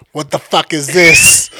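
loud slow indignant demand; retold outrage. Coworker discovering the scratched truck, loud outrage. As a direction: bark it out, shocked and angry. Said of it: "She was like".

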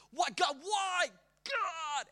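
high, slow anguished shout; crying out to God. Retold or imitated. A sufferer blaming God, shouting in anguish. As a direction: hurl the question at God.

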